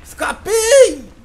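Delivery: drawn-out high, loud cheer; triumphant shout. Real, not acted. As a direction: crow it out, long and loud. Escaping the killer.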